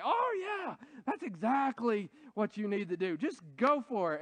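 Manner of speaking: mock-enthusiastic yes-man, pitch leaping on "Oh, yeah"; eager agreement. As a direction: play the friend who cheerily approves anything. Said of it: "Agree with us".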